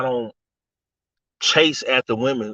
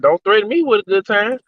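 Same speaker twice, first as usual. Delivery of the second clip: cocky retort; 7 semitones higher than usual. Retold or imitated. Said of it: "I said".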